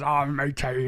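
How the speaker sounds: put-on high, loud Irish-accented exclamation; imitating an old Irish man's outburst. Old islander laughing at the student, Irish accent. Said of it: "I can't do his accent very well".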